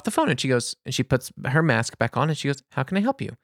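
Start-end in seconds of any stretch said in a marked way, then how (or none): 2.7-3.4 imitating a high, soft customer-service voice; lightly mimicked polite receptionist; friendly greeting. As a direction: sweetly offer help to a customer. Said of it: "in this friendly".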